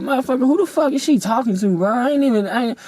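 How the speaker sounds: high, disbelieving "who the fuck is she talking to"; incredulous outburst. Retold or imitated. Telling friends how he reacted to the girl, high and incredulous. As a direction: voice jumps up in outrage. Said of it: "I said".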